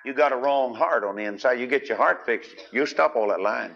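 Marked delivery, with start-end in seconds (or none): none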